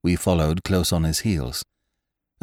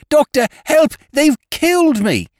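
high, loud cry for help; 7 dB louder than usual. Retold or imitated. Housekeeper imitating the caller's loud cry for help. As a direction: cry out as the dying man did. Retelling dying man's cry.

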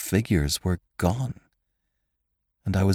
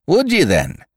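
high, loud Irish challenge; Irish cry; 8 semitones higher, 4 dB louder than usual. A narrator's reading. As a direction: challenge him in an Irish accent. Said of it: "he cried in his own unmistakable accents".

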